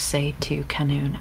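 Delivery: ordinary talk, nothing marked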